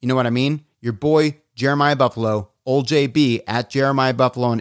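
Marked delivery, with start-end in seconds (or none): none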